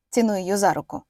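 usual speaking voice

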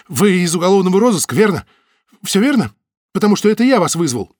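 high, loud and agitated. Acted. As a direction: press them, worked up. Butovich insists he called them.

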